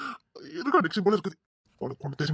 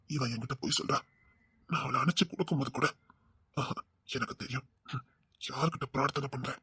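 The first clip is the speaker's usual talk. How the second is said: low menacing murmur; 6 semitones lower, 8 dB quieter than usual. Acted. Husband quietly threatens the woman he thinks is lying. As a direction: lower and much softer, menacing.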